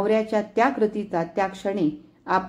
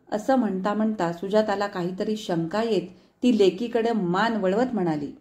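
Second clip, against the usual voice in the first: plain reading voice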